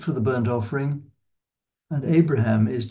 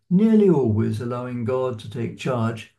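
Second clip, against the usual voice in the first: ordinary talk, nothing marked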